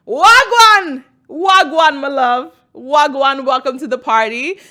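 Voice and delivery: high, loud hype wagwan; excited greeting. Real, not acted. Welcoming new listeners to the party, loud and excited.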